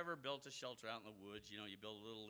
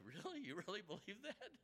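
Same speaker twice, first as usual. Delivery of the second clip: incredulous; 8 semitones higher than usual. Retold or imitated. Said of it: "I was like".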